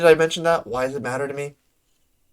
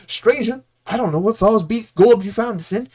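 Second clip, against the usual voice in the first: grandly grateful in a hammed-up unplaceable accent; Bodwin's muddled accent; 3 semitones higher, 4 dB louder than usual. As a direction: give hearty thanks in a wobbly rustic accent. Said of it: "I don't know what accent this is".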